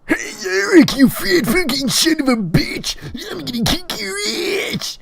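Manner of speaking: loud, high screamed challenge; hammed-up squealing fighting words; Tyler. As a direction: screech insults across the yard. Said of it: "Tyler walks out of school".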